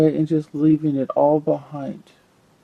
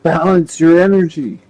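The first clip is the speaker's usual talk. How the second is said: louder, emphatic playful order; mock insistent command; 9 dB louder than usual. Tarot reader voicing an upside-down card, mock insistent. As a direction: belt it out as a comic warning from the card. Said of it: "Upside down, it's like".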